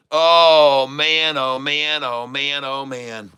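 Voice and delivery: fading, falling murmur of amazement; overwhelmed sigh. Real, not acted. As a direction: let it tumble down and fade out. Overwhelmed by the surge.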